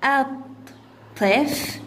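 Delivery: الطفل slowly as at, tif, lu; syllable by syllable. Shown as a demonstration. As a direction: split الطفل into three separate beats. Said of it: "تقطيعها".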